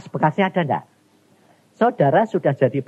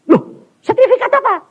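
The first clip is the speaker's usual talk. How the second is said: much higher and louder, startled question; a startled man asking God what the certificate is for; surprised self; 7 dB louder than usual. Retold or imitated. The preacher retelling his startled question to God, much higher and louder. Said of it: "dikasih sertifikat".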